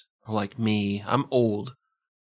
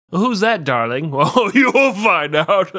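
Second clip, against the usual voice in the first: loud, high, playful put-on voice; mock wifely exclamation; 5 semitones higher, 8 dB louder than usual. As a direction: belt it out as a comic bit. Acted mock exchange as the wife.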